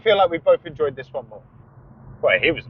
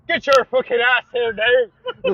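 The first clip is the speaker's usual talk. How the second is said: high, loud, drawn-out Irish yell; terrible Irish accent; 10 semitones higher, 5 dB louder, slower than usual. Acted. Ordering someone indoors, hammed-up Irish accent. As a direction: holler it like a mock-Irish parent. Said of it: "that was a terrible Irish accent".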